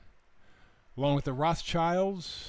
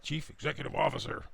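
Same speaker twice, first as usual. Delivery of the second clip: softly intoned, mock-important title; 4 dB quieter, faster than usual. Shown as a demonstration. Host mocking a grand corporate title, self-important. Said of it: "sounds important, right?".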